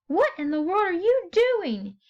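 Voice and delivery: high, shocked question; incredulous exclamation. Shown as a demonstration. Teacher demonstrating a question and exclamation together. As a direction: demand it in disbelief. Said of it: "question mark plus exclamation".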